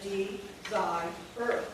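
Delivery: D, ZI, ER as three separate beats; split syllables. Shown as a demonstration. Teacher showing the unwanted extra syllable, separated beats. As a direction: break desire into "D", "zi", "er". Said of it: "We create a new syllable".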